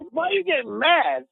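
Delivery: very high, giggly voice; laughing protest. Real, not acted. Host teasing his annoyed co-host, laughing protest. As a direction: squeal it through real amusement.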